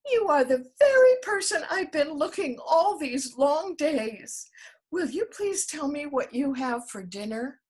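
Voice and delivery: put-on high, loud, breathless child; breathless excitement. A narrator's reading. The panting elephant's child begs the crocodile, high and loud. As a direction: gasp it out in eager rush. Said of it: "grew all breathless and panted".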